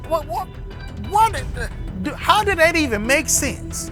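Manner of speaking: high, baffled what-what-what; can't believe what he heard; incredulous. Real, not acted. Reacting to women's quoted claim.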